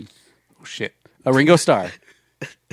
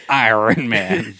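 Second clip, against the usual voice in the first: mechanical voice; 2 semitones lower, much slower than usual. Pretend. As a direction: say Iron Man like a machine. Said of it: "And here we go".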